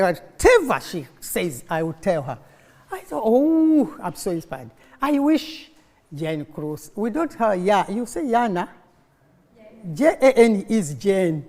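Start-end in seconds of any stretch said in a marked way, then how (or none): none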